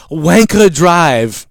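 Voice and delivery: put-on very slow drawn Wanker Drive in a Japanese accent. Restaurant guy repeating the address, Japanese accent. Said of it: "as he went".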